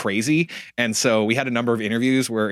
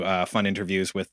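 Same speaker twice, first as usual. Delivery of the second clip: ordinary talk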